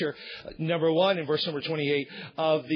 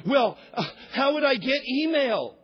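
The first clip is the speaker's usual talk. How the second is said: higher, louder, mock-helpless whine; mock whining churchgoer; 6 semitones higher, 3 dB louder than usual. Pastor mocking a congregant's excuse, higher whiny voice. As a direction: play the churchgoer who can't imagine life offline.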